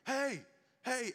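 high-pitched calls for attention; acted-out radio yell; mock yelling. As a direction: call out urgently over the radio. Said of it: "and he's yelling".

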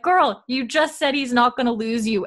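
sassy, scolding a friend on screen; exasperated girl talk. Retold or imitated. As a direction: say it like yelling at the TV. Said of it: "Also, I'm like".

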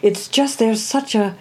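bright burst of joy; excited. Real, not acted. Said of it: "I'm doing it now".

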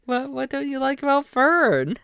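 high, indignant landlord; high character voice. Acted. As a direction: voice him high and hurt.

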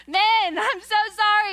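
high, loud apology broken by laughter; laughing apology. Real, not acted. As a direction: laugh through a mock sorry to the men. Mock apology to men, laughing.

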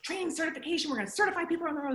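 high-pitched excited rush, speeding up; all excited. Retold or imitated. Pitching a certification idea to Jack, all excited. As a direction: re-enact bursting with a new idea. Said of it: "I'm all excited".

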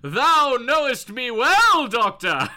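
put-on loud, slow old-timey theatrical voice; hammy stage actor. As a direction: declaim it like a Shakespearean actor. Patient voiced in old-timey English.

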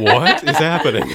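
high, laughing disbelief; slow and laughing, totally lost; baffled friend laughing out a question; laughing bewilderment. Real, not acted.

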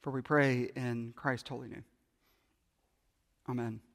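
hushed closing prayer. Acted. Pastor closing a prayer to the congregation, hushed and reverent. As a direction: end the prayer softly and reverently.

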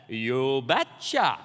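put-on very slow Norwegian-accented reply; imitated Norwegian father-in-law, slow and heavy; thick Norwegian accent. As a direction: draw it right out with a Norwegian accent. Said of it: "in his thick Norwegian accent".

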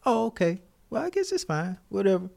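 high, soft feigned acceptance; letting it go with a fake-sweet brush-off; mock polite resignation. To the clerk at the desk, a high, soft fake-polite brush-off. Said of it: "I'm just like".

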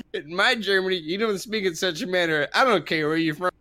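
a mock German man's line, read high through laughter; reading the quote aloud, barely holding back the giggles; laughing while reading. Reading the Applebee's story to friends, laughing through it.

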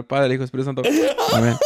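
mock blessing, breaking into laughter; cross yourself jokingly, laughing through it; laughing mock prayer. Real, not acted. Blessing himself against his friend's demon talk, laughing.

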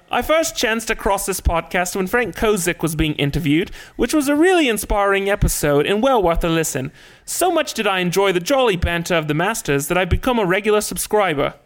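mimicked English accent. Reluctantly reading a listener's review, mock English accent. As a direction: have a go at a put-on English accent. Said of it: "a portion of it in an English accent".

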